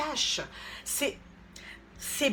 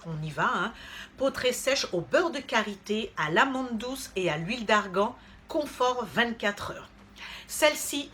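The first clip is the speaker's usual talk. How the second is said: ordinary talk, nothing marked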